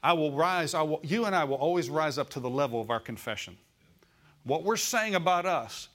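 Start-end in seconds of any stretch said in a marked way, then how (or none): 0.0-3.6 emphatic declaration. Shown as a demonstration. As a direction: push back hard against the lie. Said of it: "straighten it up and say".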